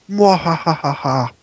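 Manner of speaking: slow hammed-up villain laugh; evil cackle. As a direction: cackle like a cartoon baddie. Evil laugh after threat.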